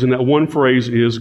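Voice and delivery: ordinary delivery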